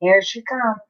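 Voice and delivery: ordinary delivery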